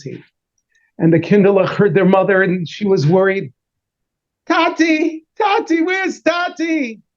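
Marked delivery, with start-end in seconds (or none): 4.5-7.0 put-on high, drawn-out child's cry for Tati; children calling. Kids calling anxiously for their missing father, high. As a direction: wail for daddy like a child.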